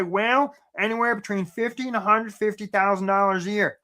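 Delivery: dopey put-on bumpkin voice; mock a clueless job seeker; Gomer. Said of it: "That's great, Gomer".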